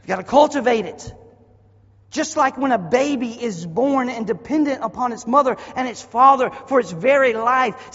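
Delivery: very loud, high preacher's cry; full-throated revival preaching; preaching at full force. Acted. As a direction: shout glory over the church.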